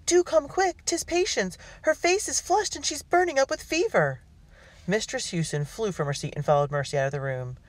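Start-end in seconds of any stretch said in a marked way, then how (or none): 0.1-4.2 very high, urgent daughter's voice; urgent high-pitched plea for help; alarmed cry. A narrator's reading. Daughter tells mother of sister's fever, high alarmed plea. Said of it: "Mercy cried".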